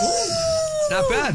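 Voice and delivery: long, drawn-out, high shaky howl; sick wolf howl. Acted. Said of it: "You sound like a sick wolf".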